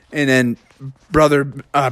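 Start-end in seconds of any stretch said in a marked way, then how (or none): none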